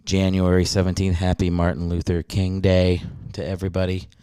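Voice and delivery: ordinary talk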